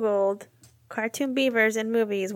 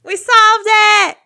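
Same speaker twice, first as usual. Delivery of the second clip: much higher and louder victory shout; triumphant cheer; 9 semitones higher, 11 dB louder, slower than usual. Real, not acted. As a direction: crow the win out loud. Triumphant after finding the answer.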